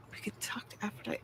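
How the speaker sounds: quick secret whisper. Pretend. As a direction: breathe it like a secret.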